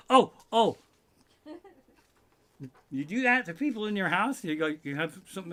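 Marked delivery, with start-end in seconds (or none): 0.1-0.8 loud, high exclamation; urgent false alarm. Retold or imitated. Teasing the household with news to announce, loud excited alarm. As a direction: call out as if something big just happened. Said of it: "I'm like".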